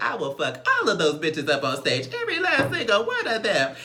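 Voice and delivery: loud mock-threatening diva, climbing high; Dre Simber. Retelling a castmate's threats, loud comic impersonation. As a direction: build an imitated rant up into a high pitch. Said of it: "I'm gonna talk in his voice".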